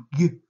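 teacher shows the class the first sound of guêpe, isolated G; G as a bare, loud voiced "guh"; isolated consonant sound. Shown as a demonstration. Teacher to pupils hunting first sounds, vibrating isolated G. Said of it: "qui vibre ici".

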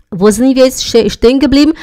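normal talking voice

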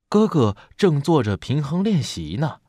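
plain reading voice

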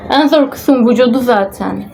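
ordinary talk, nothing marked

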